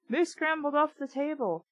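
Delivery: ordinary delivery, nothing marked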